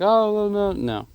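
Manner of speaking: a hint of an upset worker who glued too early; lightly voiced panic over a bad glue job; mild pretend alarm; mock dismay. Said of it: "be like".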